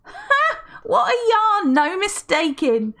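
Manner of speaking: high, loud, laughing exclamation. Acted. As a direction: still laughing as she cheers. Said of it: "Felicity laughed".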